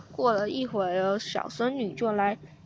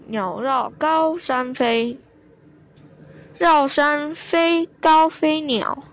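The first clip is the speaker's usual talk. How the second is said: slow recital; 4 semitones higher, slower than usual. Acted. Grandpa recites palindrome slowly.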